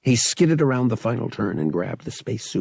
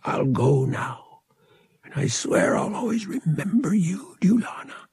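ragged voice; 7 semitones higher than usual. A narrator's reading. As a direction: whisper a tearful farewell. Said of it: "his voice ragged".

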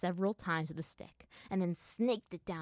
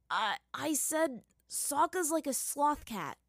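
slow, halting cover-up; said slowly; 7 semitones higher, slower than usual. A narrator's reading. Aang covering his slip to Azula, slow. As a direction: stall for time, drawing it out. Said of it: "he said slowly".

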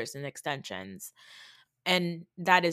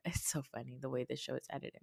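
ordinary delivery

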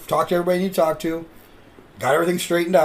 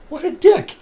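high, louder mocking voice of the fan; mock offended fan; 13 semitones higher, 5 dB louder than usual. Imitating the fan's look at the heel, mock offended. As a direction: sneer it as the insulted fan.

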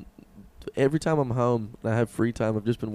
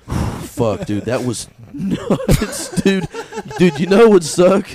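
laughing; 6 semitones higher, 8 dB louder, much slower than usual. Retold or imitated. Retelling a survivor's casual reaction.

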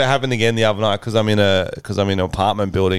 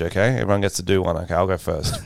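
ordinary talk

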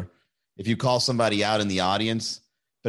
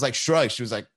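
ordinary talk

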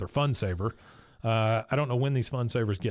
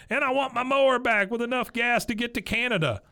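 Dale demanding; 10 semitones higher than usual. Retold or imitated.

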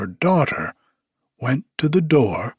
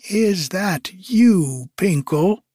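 witch's drawn-out, high-pitched call; 7 semitones higher, slower than usual. A narrator's reading. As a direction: call it out slowly, pitched up, as a question. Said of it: "cried she".

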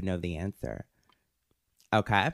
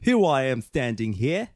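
clumsy put-on British accent; hammed-up, slightly mannish British accent; bad British accent; 3 semitones higher than usual. Shown as a demonstration. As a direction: attempt British, sounding like a guy. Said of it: "That's the best I can do".

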